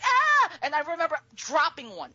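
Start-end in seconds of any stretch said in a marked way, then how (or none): 0.0-0.5 high lobster scream. Retold or imitated. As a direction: shriek back in alarm. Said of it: "And they're like".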